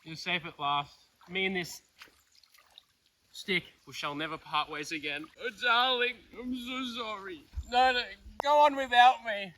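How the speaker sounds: overwrought tragic lover; melodramatic. Pretend. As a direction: ham up a tearful farewell. Melodramatic reunion with stick.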